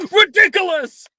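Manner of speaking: high, loud triumphant cry; spell shout. Acted. Student casting at the Boggart, triumphant shout. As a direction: fire the spell off with force.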